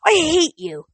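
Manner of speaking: high, loud burst of hatred; enraged. A narrator's reading. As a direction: shout it in a childlike rage. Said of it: "trembled with rage".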